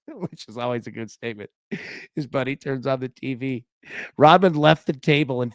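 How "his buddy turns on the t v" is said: ordinary delivery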